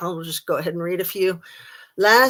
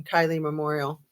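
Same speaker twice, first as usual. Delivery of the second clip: ordinary delivery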